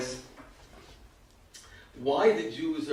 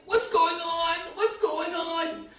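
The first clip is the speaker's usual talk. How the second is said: high, disbelieving What's going on; mock tearful disbelief; retold excited crying; 13 semitones higher, much slower than usual. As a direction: imitate a man weeping for joy at the news. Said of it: "He's crying, like".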